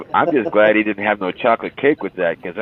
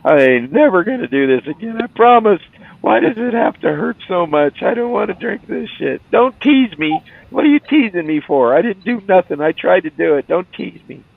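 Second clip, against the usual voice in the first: whiny crybaby; 11 semitones higher than usual. Pretend. Sick milk drinker begging friends not to tease, high whine. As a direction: whimper like a sulky kid being teased.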